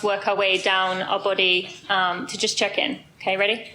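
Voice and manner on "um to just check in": ordinary delivery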